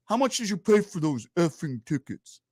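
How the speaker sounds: slow, menacing tough-guy question; slow mock Dice Clay sneer; imitating Dice Clay. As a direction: drag it out like a comic about to pounce. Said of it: "Andrew Dice Clay goes".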